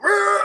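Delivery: high mock roar of pain; pained cry. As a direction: yell out in cartoon agony. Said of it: "feeling the pain".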